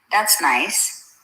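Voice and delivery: ordinary delivery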